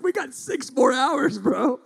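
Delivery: high, loud disbelief; exasperated, comically high outburst; incredulous protest. Retold or imitated. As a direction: re-enact pleading with your son to keep going. Acts incredulous reply to son.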